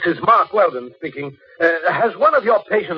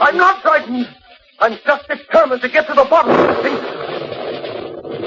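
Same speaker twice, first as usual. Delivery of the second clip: higher, slow insistent denial; pitched-up, deliberate and strained; tense denial; 7 semitones higher, slower than usual. Acted. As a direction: protest too much. Murderer denies fear, tense.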